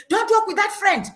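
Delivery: a parent snapping a warning; parent's warning. Retold or imitated. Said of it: "a parent will say".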